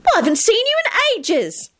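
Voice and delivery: very high, thrilled squeal; high-pitched girlish delight; delighted shriek. A narrator's reading. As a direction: shriek the greeting with joy. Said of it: "she shrieked delightedly".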